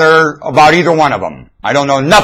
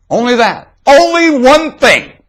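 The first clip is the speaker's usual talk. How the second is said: much higher, slow exclaiming peak; preaching climax; 11 semitones higher, 3 dB louder, slower than usual. Acted. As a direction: lift it to a ringing high point.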